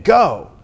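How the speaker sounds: "Go." loud and firm as a command. Shown as a demonstration. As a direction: order them out with "Go.". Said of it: "It's a command".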